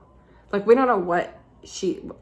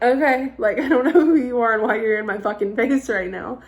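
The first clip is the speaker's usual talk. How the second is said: high, loud groggy startled okay; 4 semitones higher, 8 dB louder than usual. Retold or imitated. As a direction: blurt it out just woken and annoyed. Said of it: "that's how I reacted".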